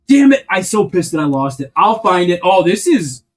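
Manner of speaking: loud high Damn it, then annoyed and loud; frustrated outburst. Real, not acted. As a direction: vent real frustration at the lost piece. Annoyed after losing piece.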